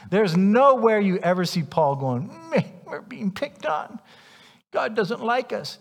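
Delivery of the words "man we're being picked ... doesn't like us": high, slow, self-pitying mock whine; a put-on pitiful moan about being picked on; mock whining. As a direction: whimper it like a sulking victim. Imagined whining complaint from Paul.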